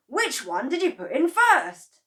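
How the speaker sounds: high, loud eager question; excited Mister Cranky. A narrator's reading. Mister Cranky pressing George, loud and high. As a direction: demand it keenly.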